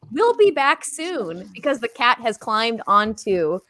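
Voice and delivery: ordinary delivery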